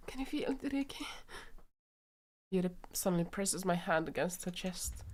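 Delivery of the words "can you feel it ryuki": breathless hush. Acted. Voices panicking Yuri.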